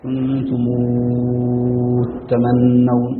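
long drawn-out vowel ending كنتمو before تمنون; full madd on كنتم. Shown as a demonstration. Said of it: "هنا يمد مدا مشبعا". (مد مشبع في آخر كنتمو)

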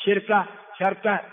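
شركة twice, silent-vowel ra, first kasra then fatha; two short variants. Shown as a demonstration. Teacher recapping correct variants, "shirka, sharka". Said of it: "هذه كلها لغة صحيحة".

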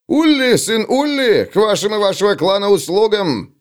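high, ringing self-announcement; proud dwarf introduction. Acted. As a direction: proclaim your name proudly. Dwarf introduces himself formally. (гордое представление гнома высоким голосом)

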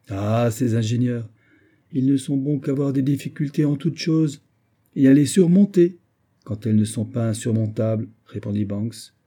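ordinary delivery, nothing marked

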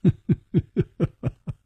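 high, light staccato laugh; amused chuckle. A narrator's reading. Hero laughing off the youth's question, amused chuckle. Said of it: "楚留香笑着说".

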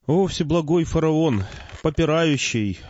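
high-pitched, drawn-out oration; solemn invocation. A narrator's reading. Narrator hails the pharaoh in his throne room, grand invocation. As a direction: hail a ruler grandly and slowly.

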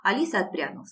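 usual speaking voice

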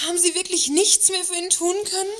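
distraught question to the director about the victim; shocked disbelief. Acted. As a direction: plead to hear there was still hope. Asking about the dead colleague.

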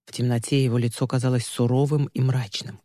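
ordinary delivery

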